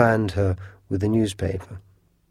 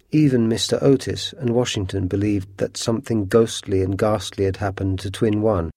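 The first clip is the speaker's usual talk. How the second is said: ordinary delivery, nothing marked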